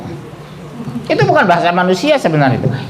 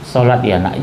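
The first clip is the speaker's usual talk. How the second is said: gentle mother; 8 semitones lower than usual. Shown as a demonstration. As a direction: ask your child tenderly, no scolding. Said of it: "Lembut sama anak".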